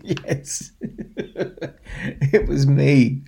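giggling through the words; laughing reveal. Real, not acted. Storyteller reveals to children that he was the man in the pot, laughing. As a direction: laugh as you let out the secret.

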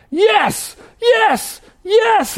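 very high imitated commentator screams; screaming. Preacher imitating commentator as Diggins inches ahead, screaming. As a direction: scream each yes as the racer pulls ahead. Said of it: "he's, he's screaming".

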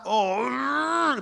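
long, high, strained groan; pent-up exasperation, held long; exasperated groan. Retold or imitated. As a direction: draw out a mock groan of mounting frustration. Acting out rising exasperation with son.

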